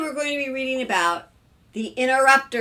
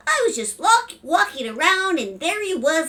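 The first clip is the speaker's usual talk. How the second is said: put-on high, louder child voice; proud little boy; 8 semitones higher, 6 dB louder than usual. A narrator's reading. Young son tells of catching frog, proud child. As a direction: explain it like a small boy.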